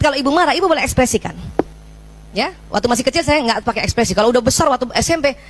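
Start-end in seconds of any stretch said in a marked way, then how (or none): none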